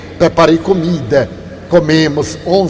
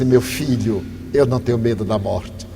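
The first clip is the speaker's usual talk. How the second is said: calm; 9 semitones lower, 8 dB quieter than usual. Retold or imitated. Quoted calm reply to robber.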